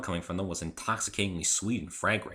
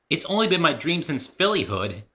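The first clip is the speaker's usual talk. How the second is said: Rainbow Dash voice; 9 semitones higher, 7 dB louder than usual. A narrator's reading. Rainbow Dash proudly tells the narrator her plan, high and loud. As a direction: announce it with swagger.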